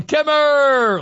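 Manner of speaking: high, long drawn-out holler of a name; stretched-out, high-pitched call; imitated yell. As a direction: holler it like calling to a passing car. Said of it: "he would yell".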